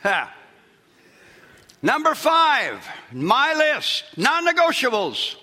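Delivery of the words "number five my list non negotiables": high, loud, slow pulpit declaration; full-force preaching. Acted. Pastor proclaiming his non-negotiable list to congregation, preaching loudly.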